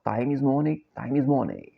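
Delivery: ordinary delivery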